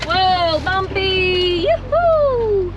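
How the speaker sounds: high, loud thrill-ride cheer; excited whoop. Real, not acted. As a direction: whoop it up over the bumps.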